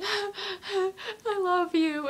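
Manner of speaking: high, loud mock-crying confession; imitating yourself wailing at an idol; retold tearful blurt. Fan with nothing else to say to an idol, mock-tearful.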